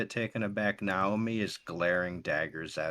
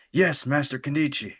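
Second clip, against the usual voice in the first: higher, louder chorus of obedient pupils; students in unison; 7 semitones higher, 8 dB louder than usual. Acted. Students answering their master together, higher and louder. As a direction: answer as one eager class. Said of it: "all of them in unison say".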